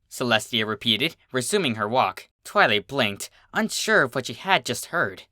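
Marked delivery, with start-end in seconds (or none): none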